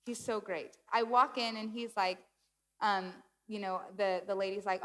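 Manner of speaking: ordinary talk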